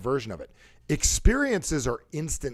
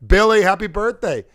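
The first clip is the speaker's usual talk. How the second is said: loud, raised birthday holler; tone for a buddy; 7 semitones higher, 10 dB louder than usual. Shown as a demonstration. Calling Billy on his birthday, loud and hearty. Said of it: "a different tone for different people".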